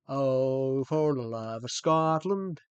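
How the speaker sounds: rally the Scots in their own accent; Scottish accent. Shown as a demonstration. Streamer plays at rallying Scotland's people, Scottish accent. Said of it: "I can do Scottish".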